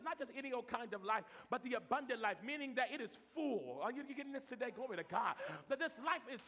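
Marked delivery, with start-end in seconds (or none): none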